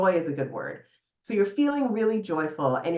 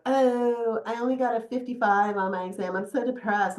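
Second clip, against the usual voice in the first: lightly voiced whiny teenager, a bit higher; mock whiny student; 5 semitones higher than usual.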